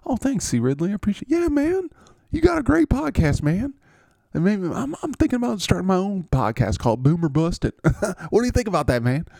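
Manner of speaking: cheerful receiver thanking the podcast host; goofy high dude voice. Acted. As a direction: play a giddy, friendly jock. C Ridley voice.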